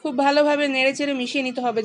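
ordinary talk

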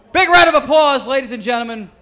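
big ringmaster introduction; announcer hype intro. Real, not acted. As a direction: sell the name to the whole room. Host introducing fighter to crowd.

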